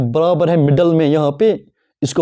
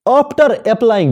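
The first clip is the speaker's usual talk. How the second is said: slow dictation; 3 semitones higher, much slower than usual. Shown as a demonstration. Dictating notes slowly.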